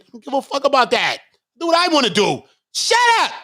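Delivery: loud, high "Shut up" snapped out; shout. Real, not acted. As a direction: tell them to shut up. Shouting down critics.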